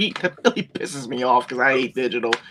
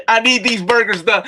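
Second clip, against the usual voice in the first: raised-pitch kitchen order, only half shouted; mock yelling; 6 semitones higher than usual. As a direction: demand it like a frantic head chef. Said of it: "it's me yelling".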